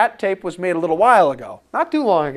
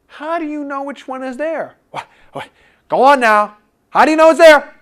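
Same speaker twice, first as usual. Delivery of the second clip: rising, louder, insistent demand; badgering the students louder and higher; pressing challenge; 6 semitones higher, 7 dB louder, faster than usual. Real, not acted. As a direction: push the class to answer. Teacher pressing students for answer.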